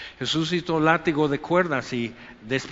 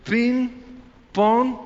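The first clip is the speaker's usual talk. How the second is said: higher and louder "ping, pong", like blades striking; mock sword sound effect; 6 semitones higher, 4 dB louder than usual. Shown as a demonstration. Preacher miming a two-edged sword cutting both ways, sharp and higher. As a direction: chop out each sound like a sword stroke. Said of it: "ping, pong y papa".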